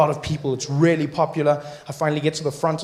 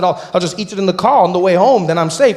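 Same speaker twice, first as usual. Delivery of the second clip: mock insistence; 4 semitones higher, 7 dB louder than usual. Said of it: "I said".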